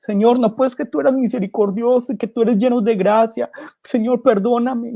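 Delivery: a preacher voicing a sinner's late plea to the Lord; desperate plea. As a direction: beg the Lord for pardon, too late. Imagined plea at judgment.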